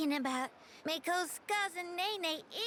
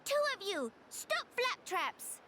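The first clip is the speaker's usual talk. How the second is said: scolding call; 7 semitones higher, slower than usual. Acted. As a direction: break up the fight. Nene interrupts the fight.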